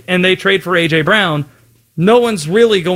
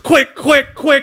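higher, urgent quick-quick; mock panic; 8 semitones higher, slower than usual. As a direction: hurry yourself like a flustered coach. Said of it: "mock panic for a trained answer".

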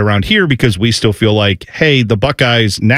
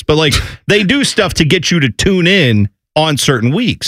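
ordinary delivery

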